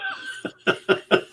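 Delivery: high airy burst of laughter; short high laugh at the punchline; high breathy laugh. Real, not acted. Laugh after the quote.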